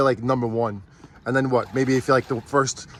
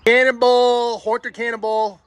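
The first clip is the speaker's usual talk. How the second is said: excited cheering; 11 semitones higher, 4 dB louder, slower than usual. Real, not acted. Cheering on a ride.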